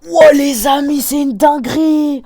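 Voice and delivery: high, loud, thrilled exclamation; amazed shout. Real, not acted. Gamer shows viewers a massive explosion, amazed high shout. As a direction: cry out in awe at a huge blast.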